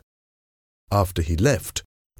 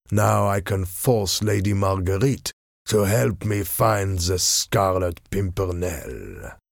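scheming masked villain. Acted. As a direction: savour each word of the scheme. Masked villain gloats over letter.

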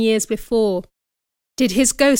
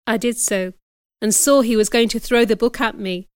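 ordinary delivery, nothing marked

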